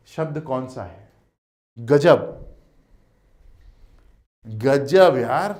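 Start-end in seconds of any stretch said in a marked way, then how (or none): 4.5-5.5 slow, drawn-out गजब यार with weight on गजब; admiring गजब यार, stretched out; stretched gajab. Shown as a demonstration. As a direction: lean hard and long on गजब. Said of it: "देखा था गजब".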